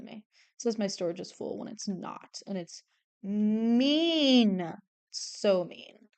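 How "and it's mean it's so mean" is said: slow, stretched pouty grumble; drawn-out complaint. Real, not acted. Complaining about a false storage warning, drawn-out pout. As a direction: drag out the word mean.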